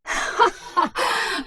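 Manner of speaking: sharp, high mocking laugh; evil laugh. Kerrigan laughs at Zeratul's horror, a high mocking cackle. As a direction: cackle in triumph at his horror.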